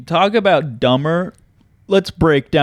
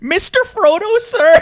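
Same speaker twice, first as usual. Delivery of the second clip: very high, hammed-up British plea; mock hobbit voice. Hobbit calling to his master, high mock British voice. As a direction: squeak it like a devoted hobbit. Acting a film character.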